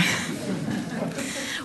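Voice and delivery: quiet unvoiced chuckle; a short breathy laugh to the crowd; soft breathy laugh. Real, not acted. Laugh at the remote-jobs line.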